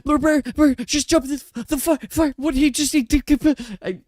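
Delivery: high, broken, panicked sputtering. Acted. Lady Sophie tells the guests the baron jumped in, sputtering. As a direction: stumble over every word in shock. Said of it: "sputtering out words".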